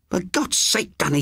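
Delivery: high pleading cry to her brother; high anguished cry of the old sister; cried out. A narrator's reading. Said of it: "she cried out".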